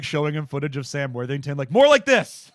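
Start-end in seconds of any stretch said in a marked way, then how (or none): 1.7-2.5 much higher, much louder command; shouted demand. Retold or imitated. Director demanding wooden acting, high loud shout. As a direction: yell it at him, pointing at the screen. Director showing actor footage.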